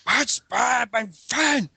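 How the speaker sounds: high, foreign-tinged dictator voice; mock dictator rant, high and odd; Hitler impression. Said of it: "I can't do a really good impression of friggin' Hitler".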